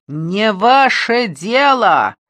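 slow, loud, high hysterical yell; hysterical shout. Acted. Suspect yelling at detective, hysterical shout. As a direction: scream it out, losing control. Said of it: "истерически закричал Бэнксон".

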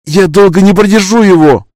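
high, loud desperate cry; strained shout. Acted. Holding shield against monster, straining.

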